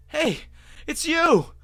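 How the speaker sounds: loud, high shout across the street. A narrator's reading. Narrator shouting after the fleeing pony. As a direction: call out to her hard. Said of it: "I shouted".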